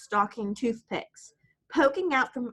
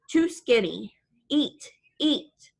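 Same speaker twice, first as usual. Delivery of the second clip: put-on grandmother's thick Italian accent, pushing food; old Italian nonna in broken English with a thick Italian accent; 3 semitones higher, slower than usual. A narrator's reading. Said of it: "says in her thick Italian accent".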